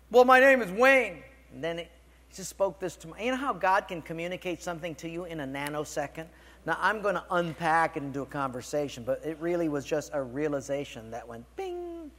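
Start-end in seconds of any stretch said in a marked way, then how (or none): 0.1-1.2 high, loud whiny protest; mock grumbling. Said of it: "Don't be grumbling". 11.6-12.1 high, soft ringing "bing"; imitated chime. Imitates instant realization chime.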